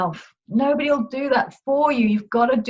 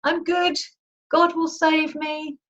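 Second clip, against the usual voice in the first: shouted up; 8 semitones higher, slower than usual. Retold or imitated. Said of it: "the preacher shouted up".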